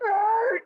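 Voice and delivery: high, loud "arr"; cartoon "arr". Rabbit spotting squirrel cheerleaders, cartoon "arr". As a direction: blurt a high cartoon noise.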